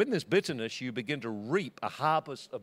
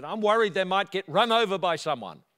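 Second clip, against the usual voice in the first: higher, louder anxious protest; raised, emphatic retold outburst; agitated worry; 5 semitones higher, 7 dB louder than usual. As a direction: imitate a worked-up neighbour explaining. Retold neighbour's heated worry.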